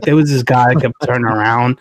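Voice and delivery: ordinary delivery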